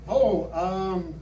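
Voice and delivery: normal talking voice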